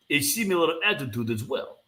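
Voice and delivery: ordinary delivery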